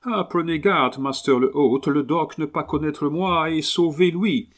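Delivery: put-on an excited English gentleman warning the host, thick English accent; strong English accent. A narrator's reading. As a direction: fort accent anglais. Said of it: "avec un accent anglais fortement prononcé".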